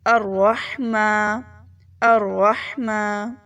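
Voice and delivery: slow drawn-out الرحمن; slow recitation. Shown as a demonstration. Teacher reciting الرحمن for practice. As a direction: recite الرحمن slowly with heavy r. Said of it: "اب ہم اسے پورا پڑھتے ہیں".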